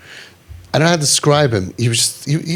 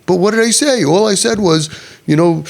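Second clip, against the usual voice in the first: higher-pitched imitation of self-justifying people; defensive protest; 6 semitones higher than usual. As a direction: protest innocence in a put-on insistent voice. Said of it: "People will say that".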